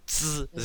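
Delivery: the z of 怎 buzzed on its own; z sound. Shown as a demonstration. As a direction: hiss out the z at the start of 怎. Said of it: "Like with the".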